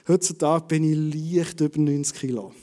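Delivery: über stressed, not leicht; über weighted, leicht passed over. Shown as a demonstration. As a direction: lean on the word über, leave leicht light. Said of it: "Mit Betonung auf über und nicht auf leicht".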